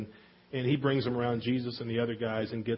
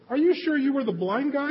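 incredulous question; 13 semitones higher, 4 dB louder than usual. Acted. Religious people doubt healed man.

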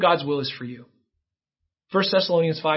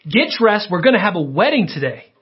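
raised, high-pitched proclamation; exuberant command; 5 semitones higher, 5 dB louder than usual. Acted. The Lord calling off a funeral for a wedding, exuberant command. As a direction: call it out over a cheering crowd.

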